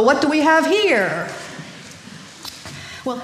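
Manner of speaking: ordinary delivery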